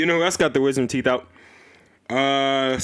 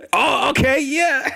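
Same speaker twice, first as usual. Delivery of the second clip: high, delighted double take; pleasantly shocked, voice jumping up; surprised delight; slower than usual. Retold or imitated. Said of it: "are all like".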